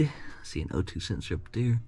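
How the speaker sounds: whispered aside. Real, not acted. Muttered aside while working.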